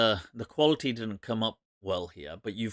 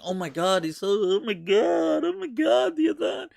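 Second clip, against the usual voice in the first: squealing high mock sob of awe; mock emotional wail; 7 semitones higher than usual. Mocking overemotional believers with a high gushing wail. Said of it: "people saying".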